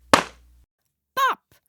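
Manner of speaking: high loud popping sound; a sudden loud pop. A narrator's reading. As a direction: burst it out sharp. Balloon ball bursts.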